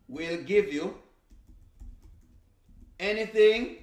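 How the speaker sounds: ordinary talk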